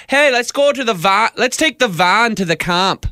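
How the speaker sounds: high-pitched exaggerated Winnipeg accent. Co-host mimicking folks from Winnipeg, exaggerated Winnipeg accent. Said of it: "Winnipeg ... They're like".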